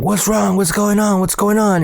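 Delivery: high-pitched urgent retold questions; alarmed questioning. Maintenance man to boys freaking out, alarmed. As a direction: imitate a worried man, pitched well up.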